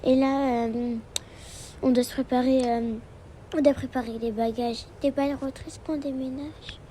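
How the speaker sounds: ordinary delivery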